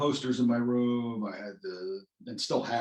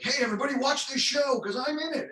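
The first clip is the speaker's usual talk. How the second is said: mock bragging; 14 semitones higher, 4 dB louder than usual. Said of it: "don't wanna be like".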